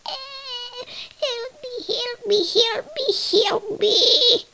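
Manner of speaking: very high-pitched sobbing pickle guy; mock sobbing. Pickle guy begging for help, mock sobbing squeal. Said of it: "a pickle guy sobbing, like".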